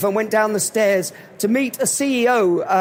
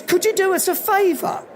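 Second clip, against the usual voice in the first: higher-pitched Cockney request; Cockney guy; 8 semitones higher than usual. Acted. Cockney fan asks [name] a favour, high Cockney voice. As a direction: ask cheekily in a light Cockney accent. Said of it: "little English Cockney guy".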